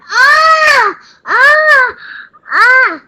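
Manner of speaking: high, loud cries of pain; hunter's scream of pain. A narrator's reading. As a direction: scream as the snake bites your foot. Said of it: "The hunter screamed".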